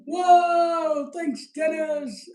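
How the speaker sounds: a loud, high whoa of thanks, slowed down; excited shout. Real, not acted. Livestream host thanking a donor for the donation, excited shout. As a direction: cheer the donor loudly.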